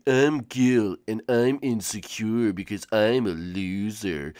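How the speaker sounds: low, soft Gil voice; a hint of gloomy Gil; Gil impression. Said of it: "I bet she can't do Gil".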